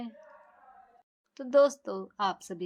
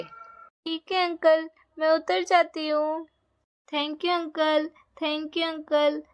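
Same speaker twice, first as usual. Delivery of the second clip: put-on a higher, eager child thanking the uncle; little girl voice; 5 semitones higher than usual. Little girl thanking the horse man, cheerful child voice. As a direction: sound like a happy little girl.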